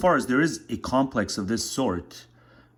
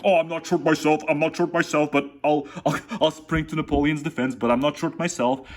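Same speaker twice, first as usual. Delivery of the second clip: mock defensive guy; 6 semitones higher than usual. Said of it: "announce that, like".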